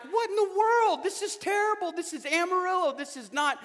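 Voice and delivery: mock outrage about the traffic cones; fed-up rant. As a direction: vent like a stuck commuter fed up with the roadwork. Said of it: "and you're like".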